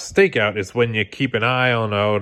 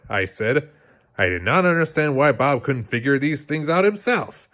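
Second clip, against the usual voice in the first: ordinary delivery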